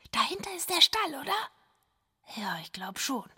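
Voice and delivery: leises Flüstern neben der Krippe; flüstere, um das Baby nicht zu wecken; whispering over a crib. Acted. (ganz leise geflüstert)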